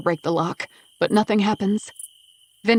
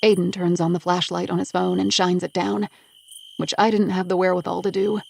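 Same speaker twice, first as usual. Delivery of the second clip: usual speaking voice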